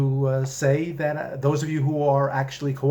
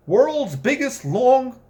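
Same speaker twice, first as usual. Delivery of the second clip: high, slow showman read; sign read out big; 12 semitones higher, 6 dB louder, slower than usual. Acted. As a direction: announce it like a carnival barker. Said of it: "sign reading".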